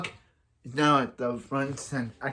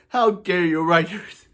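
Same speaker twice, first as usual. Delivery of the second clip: mock outrage; 5 semitones higher, 6 dB louder than usual.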